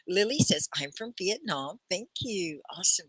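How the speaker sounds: usual speaking voice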